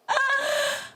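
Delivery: high, short squeal of awe; high gasping squeal. Real, not acted. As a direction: squeal at something amazing. Reacting in awe to video.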